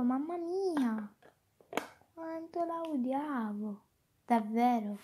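ordinary delivery

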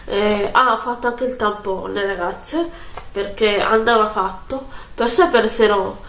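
ordinary delivery

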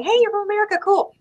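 a hint of a welcoming local; friendly local. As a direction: greet the visitor like a pleased stranger. Imitating friendly Maltese locals.

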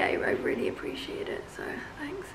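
dry whispered sarcasm; sarcastic whisper. Real, not acted. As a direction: hiss the thanks under your breath. Sarcastic thanks to late friend.

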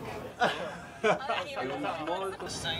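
long, soft laugh. Real, not acted. After singing his own silly song, laughing. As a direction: dissolve into breathy laughter.

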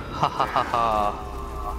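hammed-up evil chuckle; slow theatrical ha ha ha; slow mock villain laugh. Mock villain laugh after gasp.

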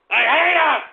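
high, loud outburst of hatred; angry yell. Real, not acted. Host erupts about hypocrites, yelling angrily. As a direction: yell it out in a sudden burst.

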